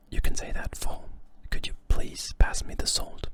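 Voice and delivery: ordinary talk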